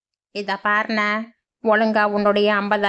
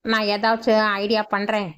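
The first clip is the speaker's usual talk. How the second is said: normal talking voice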